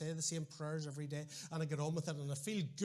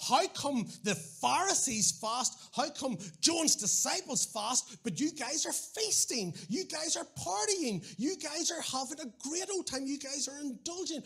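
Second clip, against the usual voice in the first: higher, louder and slow, griping accusation; hungry disciples accusing Jesus's followers, indignant and aggrieved; indignant complaint; 8 semitones higher, 5 dB louder, slower than usual. Retold or imitated. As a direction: whine it out like offended fasters. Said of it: "And so they say".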